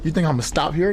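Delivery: ordinary talk, nothing marked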